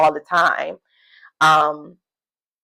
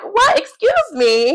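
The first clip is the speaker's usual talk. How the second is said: shocked disbelief; 4 dB louder, much slower than usual. Retold or imitated. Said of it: "And I was like".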